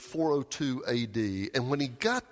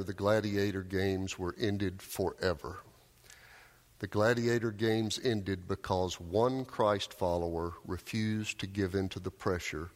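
ordinary talk

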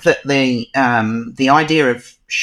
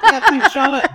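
put-on Australian accent; 12 semitones higher than usual. Joking thanks in Charlotte's voice.

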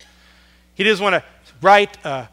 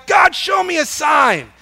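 loud, higher, slow desperate cry; yelling to God; 7 semitones higher, 5 dB louder than usual. Retold or imitated. Desperate believer yelling to God for a sign, loud. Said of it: "we just yell to God".

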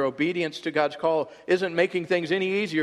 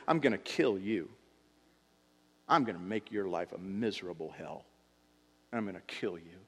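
menacing threat; 7 semitones lower, 5 dB quieter than usual. Retold or imitated. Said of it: "she said to Elijah through a messenger".

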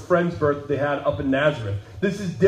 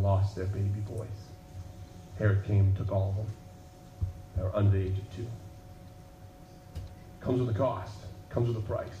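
lower, soft and grave; solemn grief; 8 semitones lower, 6 dB quieter, faster than usual. Acted. Preacher telling the moms of Bethlehem's loss, hushed and grave. Sombre turn in sermon.

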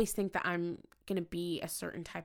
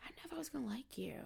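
hushed confession; 8 semitones higher, 13 dB quieter, much faster than usual. Retold or imitated. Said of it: "they're like".

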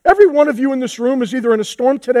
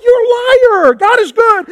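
much higher, loud calling-out of a liar; playful loud accusation; blunt mock call-out; 9 semitones higher, 3 dB louder than usual. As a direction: call the bluff, half joking, up high. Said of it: "And I'm like".

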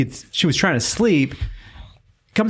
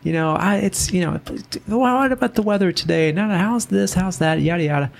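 put-on higher, chatty little old man voice; sweet old neighbor; 7 semitones higher than usual. Renter imitates his elderly neighbor's friendly chatter. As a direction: play the sweet old neighbor making small talk. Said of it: "And he".

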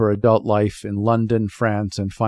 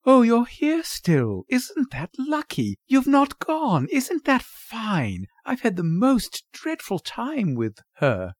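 high-pitched, fluttery relief with drawn-out words; excitable society mother; gushing mother; 14 semitones higher, slower than usual. Acted. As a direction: gush as if catching someone just in time.

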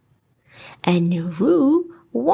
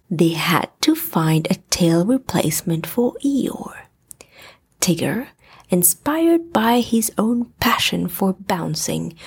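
ordinary delivery, nothing marked

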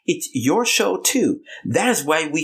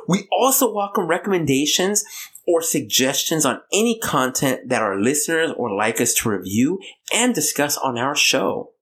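ordinary talk, nothing marked

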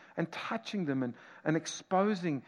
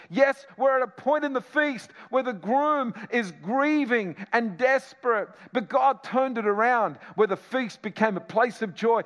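rising preaching; 7 semitones higher, 8 dB louder than usual. Acted. Preacher rises to a climax again.

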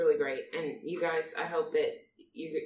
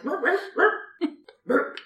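mock burping sounds; 10 semitones higher, 7 dB louder, slower than usual. Joking about burping at him.

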